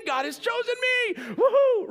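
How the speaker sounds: very high, loud whooping shout; triumphant cheer. Retold or imitated. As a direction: crow it out like a teenager who just won. Teen Joseph cheers in triumph.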